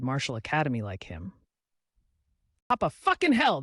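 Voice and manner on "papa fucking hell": startled curse. Acted. Startled by a sudden outburst.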